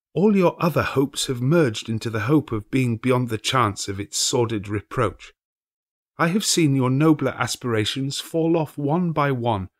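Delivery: lightly voiced grieving girl; young girl's voice. A narrator's reading. The girl tells Scrooge another idol replaced her, sadly.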